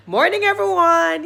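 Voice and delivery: high, loud, drawn-out hello; public greeting. Retold or imitated. Storyteller greeting the conference audience, projected greeting. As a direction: address a whole room.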